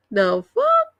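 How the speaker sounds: very high incredulous outburst; what on earth; stunned. Retold or imitated. Reacting to Misty still outside, stunned and high. Her own shocked reaction, retold.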